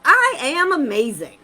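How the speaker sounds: I am amazing, called out loud; loud affirmation. Shown as a demonstration. Coach demonstrates the alarm affirmation, loud and emphatic. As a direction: shout it like you mean it. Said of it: "you go".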